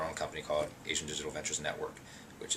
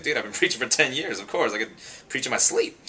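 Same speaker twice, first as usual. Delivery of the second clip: laughing; 7 semitones higher, 6 dB louder than usual. Retold or imitated. Laughing boast to Kev.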